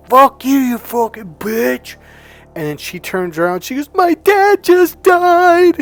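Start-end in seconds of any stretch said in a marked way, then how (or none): 0.1-1.9 high, loud, drawn-out insult; imitated angry yell. Friend yelling after girl who kept walking, angry. 3.9-5.8 very high, loud, drawn-out wail; imitated bawling cry. Crying girl turning on rude friend, imitated wail. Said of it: "she's fucking bawling".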